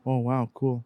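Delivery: low, soft, underwhelmed reaction; mock indifference, low and quiet; unimpressed Joey impression. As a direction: react to a jackpot with no excitement. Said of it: "this is Joey hitting the lottery".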